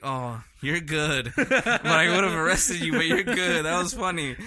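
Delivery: imitating a cop laughing through her words; a cop cracking up at the prank, retold.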